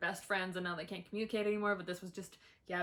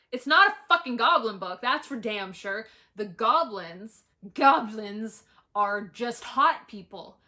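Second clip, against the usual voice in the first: loud, exasperated rant, slowing on goblins; angry rant; 11 dB louder, slower than usual. Real, not acted. Reviewer ranting to viewers about fake goblins. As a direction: vent it at full volume.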